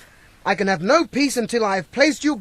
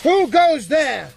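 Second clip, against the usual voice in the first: commanding halt, higher and slow; challenge; 7 semitones higher, much slower than usual. Acted. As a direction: stop the stranger with command. Knight challenging a stranger.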